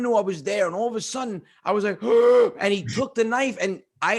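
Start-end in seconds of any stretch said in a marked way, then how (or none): 2.1-2.6 sharp loud shocked gasp; loud startled gasp. Retold or imitated. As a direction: suck in air in horror. Child seeing goat killed.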